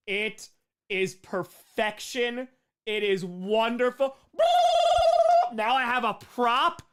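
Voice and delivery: slow, high, loud proclamation; drawn-out, thrilled declaration; emphatic delight. Real, not acted. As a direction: relish each word triumphantly. Admiring the gift.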